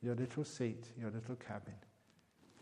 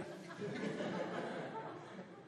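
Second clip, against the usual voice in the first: breathy laugh, much higher; high airy laugh; 5 dB quieter than usual. Real, not acted. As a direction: burst into laughter. Laughing at own joke.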